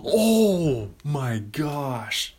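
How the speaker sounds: loud, very slow, stretched out; excited. Real, not acted. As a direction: drag it out, overwhelmed with glee. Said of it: "See how excited?".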